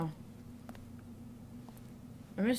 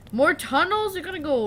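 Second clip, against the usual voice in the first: a sharp high outburst of disbelief; can't believe there are more tunnels; exasperated surprise; 9 semitones higher, 7 dB louder than usual. Real, not acted.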